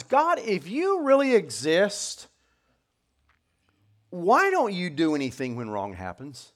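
loud, high, drawn-out plea; acted exasperated appeal from the pulpit; challenging God. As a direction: confront God in the prophet's place. Voices prophet challenging God.

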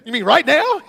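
high, loud disbelief; can't believe it; startled. Retold or imitated. Pastor handed the mic, high startled question. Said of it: "I said".

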